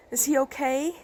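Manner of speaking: high, worried voice; pleading, high-pitched worry; anxious question. Acted. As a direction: ask fearfully after your hurt child. Mother asks after wounded son.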